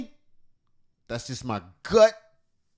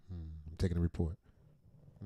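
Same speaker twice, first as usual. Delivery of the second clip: low, very soft listening voice; mock therapist; 9 semitones lower, 13 dB quieter, faster than usual. Host correcting himself, as a quiet mock therapist. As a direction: murmur like a therapist taking notes. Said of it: "as a therapist might".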